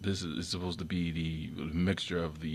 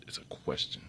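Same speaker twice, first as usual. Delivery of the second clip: whispered aside; 8 dB quieter than usual. Real, not acted. To viewers, whispering that it is only a question. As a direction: whisper it softly. Quiet aside after claim.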